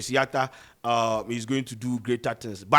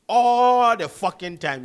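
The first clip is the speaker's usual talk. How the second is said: slow, loud and higher, leaning on every word; emphatic outburst; 8 semitones higher, 7 dB louder, slower than usual. Real, not acted. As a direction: hammer it home in exasperation. Venting about fights among boys.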